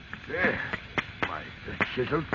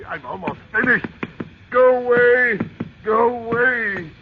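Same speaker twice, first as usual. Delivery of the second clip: frantic shout; 10 semitones higher, 7 dB louder than usual. Acted. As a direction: drive them off in a panic. Geoffrey screams them away.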